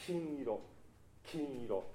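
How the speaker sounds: 金色 with a sharp, projected k; 金色 with a hard, clear k; crisp k. Shown as a demonstration. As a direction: spit out the k of 金色. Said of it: "かなり発音を立てて".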